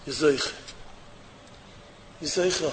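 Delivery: low and soft; hushed. Real, not acted. Rabbi to listeners, low hush. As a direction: say it under your breath.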